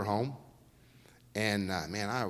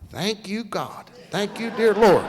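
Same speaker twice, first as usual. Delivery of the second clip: higher, loud, half-joking relief; mock grateful prayer; 8 semitones higher, 8 dB louder, slower than usual. Real, not acted. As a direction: thank heaven it's over. Mock relief motor blew.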